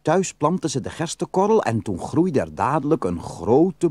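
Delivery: ordinary delivery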